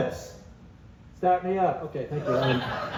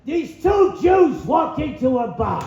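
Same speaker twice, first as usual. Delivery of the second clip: Gilbert Gottfried impression; 6 semitones higher than usual. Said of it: "Gilbert Gottfried, here we go".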